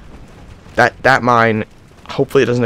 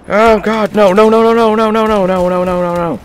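high, loud stream of desperate no's; panicked no's; 10 semitones higher, 6 dB louder than usual. Real, not acted. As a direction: plead frantically as you die. About to die in game.

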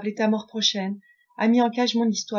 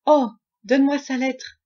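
higher, louder burst of eagerness; eager plea; 4 semitones higher, 6 dB louder than usual. Acted. Prince begging his friend for the letter, eager outburst. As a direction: cry out, desperate to have it.

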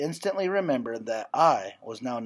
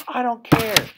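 higher, louder angry yell; hurt shout; 8 semitones higher, 7 dB louder, slower than usual. A narrator's reading. Girl shouts at her departing best friend from the stairs, hurt angry yell. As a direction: fling it down the stairs in a hurt rage. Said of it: "I shouted at her from the stairs".

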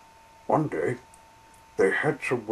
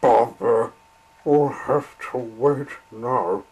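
annoyed; 7 dB louder than usual. Acted. Engine stopped at signal.

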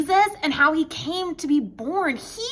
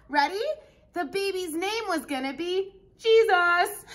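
ordinary delivery

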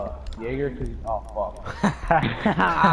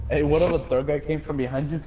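ordinary talk

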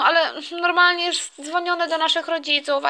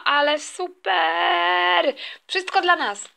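slow, drawn-out "super"; child thrilled at the empty playground; delighted; 3 semitones higher, much slower than usual. Acted. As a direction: savour the word "super", delighted.

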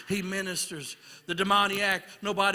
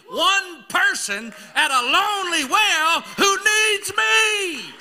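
higher, louder, slowed and cracking; crying out; 9 semitones higher, 6 dB louder, slower than usual. Acted. Preacher crying out as Jesus to the congregation. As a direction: cry out the need in his voice.

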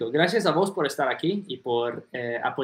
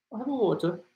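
British phrase with r-less "water"; British accent; 5 semitones higher, 4 dB quieter, faster than usual. Shown as a demonstration. Teacher demonstrating how they say agua, British accent. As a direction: say it like a Brit, water as WAW-tuh. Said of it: "Eso es muy diferente".

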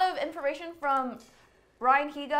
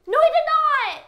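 very high, loud squeaky denial; chipmunk-high helium protest; 12 semitones higher, 10 dB louder than usual. Real, not acted. Same denial after helium.

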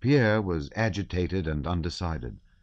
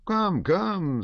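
indulgent; 13 semitones higher than usual. A narrator's reading. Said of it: "recovered from his excitement".

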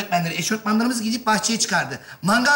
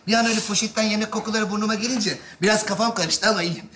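normal talking voice

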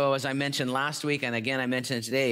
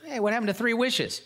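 high indignant question; protest, pitched way up; surprised; 9 semitones higher than usual. Acted. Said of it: "The guy said".

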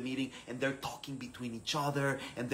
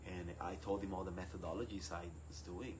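ordinary talk

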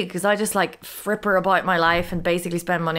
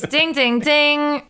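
ding sound; 6 semitones higher, 3 dB louder, much slower than usual. Pretend. Correct-answer bell in quiz game.

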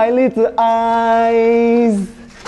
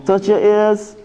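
ordinary delivery

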